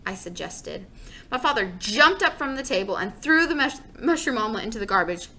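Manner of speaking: usual speaking voice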